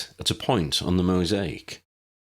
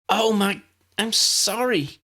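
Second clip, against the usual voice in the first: high, breathless panicked apology; 4 dB louder, slower than usual. A narrator's reading. Tally panics after stunning Ron by mistake. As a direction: gasp it out in a panic, high and a little louder. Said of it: "Tally sounded panicked".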